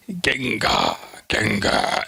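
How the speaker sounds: a barely-there bad Japanese accent on Ginga; mock Japanese accent, lightly done. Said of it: "I'll just do a bad Japanese accent".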